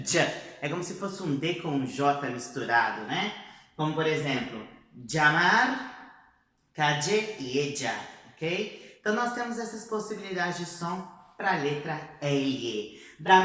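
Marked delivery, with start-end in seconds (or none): 5.1-7.9 llamar said as djamar, ll like a D and J blended; dj sound. Shown as a demonstration. Teacher models the dj-sound ll. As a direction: ll as dj: djamar, calle, ella. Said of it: "Seria o som de j".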